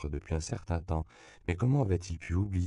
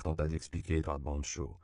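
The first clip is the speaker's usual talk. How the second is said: ordinary delivery, nothing marked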